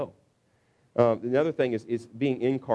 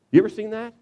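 normal talking voice

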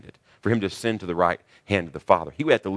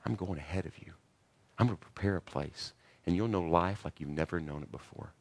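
far softer, gentle promise; tender reassurance; 2 semitones lower, 10 dB quieter than usual. Retold or imitated. Jesus to his disciples, soft and tender. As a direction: comfort them softly. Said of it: "See the difference?".

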